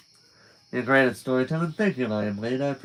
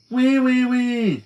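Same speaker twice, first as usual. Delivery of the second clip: high, loud teasing pig squeal; mock the girl with a squeal; 10 semitones higher, 5 dB louder, slower than usual. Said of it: "joining in".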